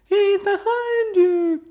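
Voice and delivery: very high, loud, slow pantomime shout; panto warning cry. Pretend. Host playing the panto audience to listeners, high drawn-out shout. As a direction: yell it like a panto crowd warning the hero. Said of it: "panto mode".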